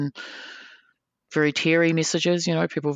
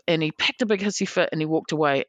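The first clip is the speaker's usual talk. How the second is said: ordinary delivery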